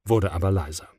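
ordinary delivery

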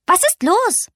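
hohe, piepsige Igelstimme; besorgter Igel. Acted. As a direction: frag hoch und besorgt wie ein kleiner Igel. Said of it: "fragte der Igel". (der Igel fragt die weinende Eule, hoch und besorgt)

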